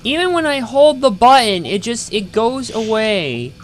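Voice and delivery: venting at a button that won't hold; real frustration rising then easing; exasperated outburst. Real, not acted. Controls failing, exasperated complaint.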